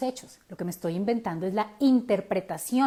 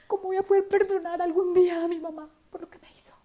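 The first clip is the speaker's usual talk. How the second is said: whispered despair; 8 semitones higher than usual. Retold or imitated. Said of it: "yo era como".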